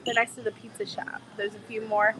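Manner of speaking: normal talking voice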